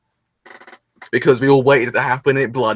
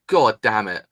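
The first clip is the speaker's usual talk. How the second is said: normal talking voice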